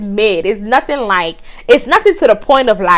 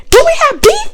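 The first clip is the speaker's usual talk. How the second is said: much higher, loud taunting mock challenge; mock confrontation; 4 dB louder than usual. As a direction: dare him, retold with attitude. She confronts him after throwing tea.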